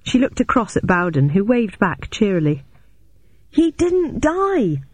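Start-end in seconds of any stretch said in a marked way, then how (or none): 3.5-4.8 high, drawn-out urgent call; excited calling out. A narrator's reading. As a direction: call the news out high and slowly. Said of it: "I continued".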